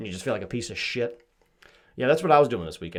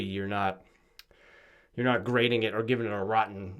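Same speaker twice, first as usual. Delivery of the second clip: ordinary talk, nothing marked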